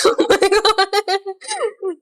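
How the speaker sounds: helpless laughter through the words; words dissolving into high laughter; cracking up. Real, not acted. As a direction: lose it laughing mid-phrase. Cracks up at the video.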